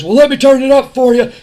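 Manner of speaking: loud, high taunting wrestler voice; Austin taunting. Retold or imitated. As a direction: needle him like a smug tough guy. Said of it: "Austin says".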